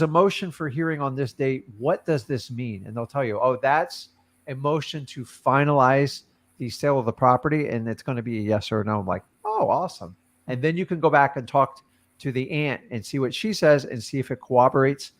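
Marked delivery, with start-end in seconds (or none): none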